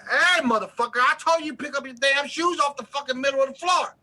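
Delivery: high, loud cursing mother; hammed-up screaming mother; furious mom yell. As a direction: blow up like a mom at the end of her rope. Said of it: "your mother turned".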